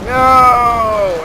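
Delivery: loud, high cry of No; mock shout. Gamer yells No as his position falls, loud and high. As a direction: yell it in mock despair. Gamer yelling at enemy push.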